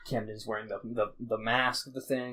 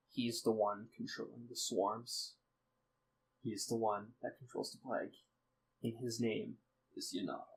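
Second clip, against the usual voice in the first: grave revelation; 10 dB quieter than usual. Acted. Grieving father names the villain.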